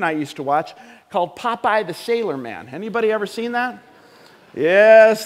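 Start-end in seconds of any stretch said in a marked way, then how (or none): 4.5-5.3 calling out. Real, not acted. Calling out to the congregation.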